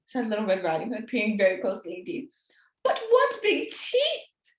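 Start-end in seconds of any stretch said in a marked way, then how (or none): 2.8-4.5 put-on girl's wonder. Girl's last question.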